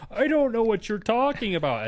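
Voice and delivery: high, mock-innocent denial; feigned innocence. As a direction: play dumb, imitating the kid's squeaky denial. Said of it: "He's like".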